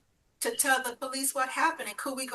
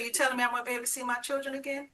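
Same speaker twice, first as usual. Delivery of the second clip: hurried panic; 3 semitones higher, much faster than usual. Retold or imitated. Ex-husband panicking.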